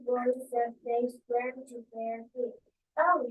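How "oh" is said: a sharp, louder gasped oh; a startled, winded cry; breathless exclamation. A narrator's reading. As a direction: caught off guard, calling out. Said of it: "she exclaimed breathlessly".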